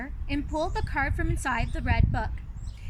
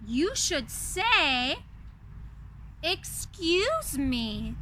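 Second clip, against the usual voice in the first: slow teaching; much slower than usual. Acted. Mother teaching manners.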